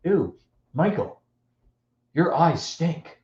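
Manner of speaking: slow, drawn-out teasing revulsion; mock disgust. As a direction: stretch it out to needle a little brother. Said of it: "Gets to Michael's face and says".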